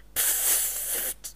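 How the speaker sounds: soft puff of breath; a quiet unvoiced pfft; airy pfft blow. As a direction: blow the air out through the lips. Blowing at the flying flame.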